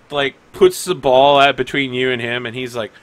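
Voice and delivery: ordinary delivery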